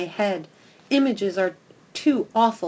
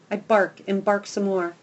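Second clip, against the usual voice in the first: plain reading voice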